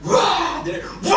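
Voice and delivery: loud, high-pitched cry of amazement; thrilled whoop. Real, not acted. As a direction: whoop with the cheering crowd. Reacting to the roaring practice cheer. (흥분해서 터뜨리는 환호)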